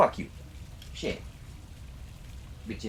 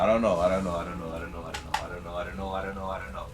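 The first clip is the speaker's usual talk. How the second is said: lower, softer, trailing giggly chant; giggly sing-song, fading; 8 semitones lower, 6 dB quieter, much faster than usual. Real, not acted. Streamer giggling to himself, fading sing-song. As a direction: let it dissolve into giggles. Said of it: "I'm so giggly right now".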